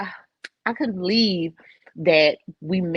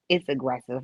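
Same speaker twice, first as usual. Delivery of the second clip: ordinary talk, nothing marked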